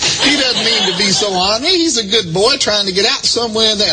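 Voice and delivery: high, pleading mock-prayer voice; praying reverend impression. Reverend praying for his threatening caller, high imitated prayer. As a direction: pray aloud in a lifted preacher voice.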